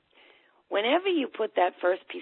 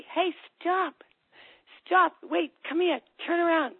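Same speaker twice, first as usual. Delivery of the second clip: high-pitched, urgent calls to halt; calling out; 10 semitones higher than usual. Acted. Parent stopping a child walking away, calling out. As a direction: call someone back as they leave.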